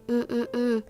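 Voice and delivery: normal talking voice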